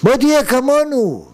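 loud, insistent acted invitation; evil inclination's call. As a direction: call out as the tempter luring someone over. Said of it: "יצר הרע".

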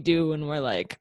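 ordinary talk

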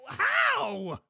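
very high, loud drawn-out cry; real whooping outburst of wonder; amazed shout. Real, not acted. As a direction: burst out in astonishment. Amazement peaks, words fail him.

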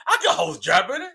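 usual speaking voice

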